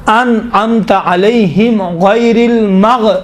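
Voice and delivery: slow, measured tajweed recitation; deliberate teacher's recitation; Quran recitation demo. Shown as a demonstration. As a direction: recite slowly, breaking off mid-word to correct. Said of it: "تو مغ نہیں ہے".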